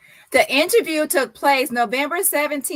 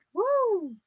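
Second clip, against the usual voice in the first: high celebratory woo; excited whoop; 10 semitones higher, 3 dB quieter than usual. Real, not acted. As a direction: let out a happy whoop. Celebrating the news.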